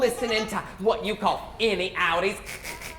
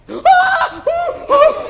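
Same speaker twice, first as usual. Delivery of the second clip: high loud playful shriek; mock scream; 12 dB louder than usual. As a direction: scream at the worm. Sees the worm.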